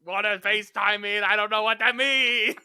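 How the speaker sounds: put-on loud, high old man rant; cranky old man. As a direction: shout it like a confused grandpa.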